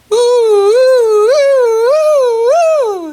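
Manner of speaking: very high loud siren ooh; loud fire siren imitation; siren wail. Shown as a demonstration. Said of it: "How does that sound".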